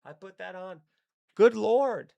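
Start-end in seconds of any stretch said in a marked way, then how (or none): 1.3-2.1 a big stunned "Good Lord", loud and high; loud shocked exclamation. Real, not acted. Comic recalling the plane movie, a loud stunned outburst. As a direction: blurt it out like the movie just floored you.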